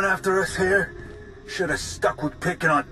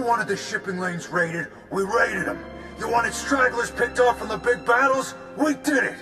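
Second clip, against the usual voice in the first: indignant, pitched up high; high-pitched angry self-defense; heated argument; 4 semitones higher than usual. Acted. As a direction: throw it back at an accusing ally. Gang member argues back with ally.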